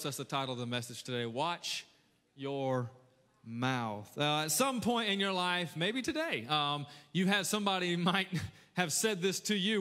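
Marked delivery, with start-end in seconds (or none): 1.2-4.1 word by word, with pauses; note-taking dictation. Shown as a demonstration. Preacher to note-takers, spaced-out dictation. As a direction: give the title out one separate word at a time. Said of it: "If you're taking notes".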